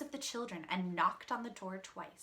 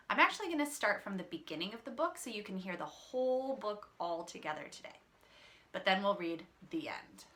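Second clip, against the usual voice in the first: usual speaking voice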